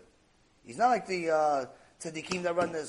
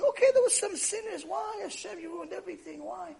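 mock crying plea, pitched way up; hysterical crying; 11 semitones higher than usual. Noah pleading with God over the ruined world, hammed-up crying. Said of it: "He starts crying, hysterical crying".

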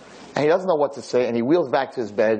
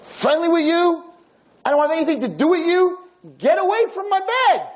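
put-on grumpy old man. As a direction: spit it out like a bitter old crank. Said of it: "the most miserable, grisly old man".

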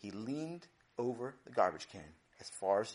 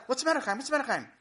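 high, loud, worried repeated question; alarmed; 12 semitones higher, 6 dB louder, much faster than usual. Acted. Parents running outside to Chaim, alarmed. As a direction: rush out asking in alarm.

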